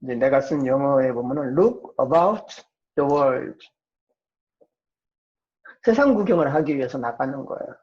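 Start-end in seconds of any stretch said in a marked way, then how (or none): none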